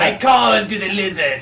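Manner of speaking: high, loud cartoon villain cry; villain shout. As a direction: bellow it like a hammy supervillain. Mocks villain calling lizards.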